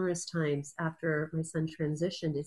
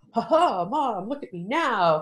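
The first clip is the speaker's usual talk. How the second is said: loud, higher, playful imitation of a triumphant hero; superhero boast; 4 semitones higher, 8 dB louder, slower than usual. Son showing off to his mom, mock superhero voice. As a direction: crow it like a show-off superhero, slowing at the end. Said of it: "you know, this superhero".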